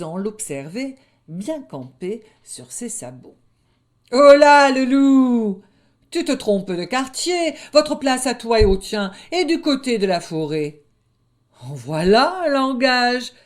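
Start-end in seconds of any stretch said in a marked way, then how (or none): 4.1-10.8 high, loud lamb voice scolding the wolf; a cheeky lamb telling a wolf off; high bleating lamb, loud and bold; bold young lamb. Acted. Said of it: "lamb scolds the wolf". 11.6-13.3 high, loud outraged wolf cub; young wolf taken aback, louder; indignant young wolf. Acted. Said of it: "repartit le louvard".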